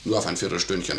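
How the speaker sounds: ordinary delivery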